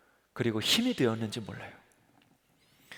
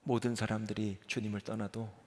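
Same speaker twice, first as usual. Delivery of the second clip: softly spoken promise; quiet vow; 4 semitones lower, 11 dB quieter than usual. Acted. Peter vowing to Jesus, soft and low. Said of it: "이거 누가 한 말이에요".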